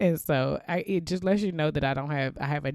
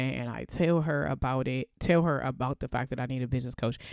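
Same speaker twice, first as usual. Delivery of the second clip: normal talking voice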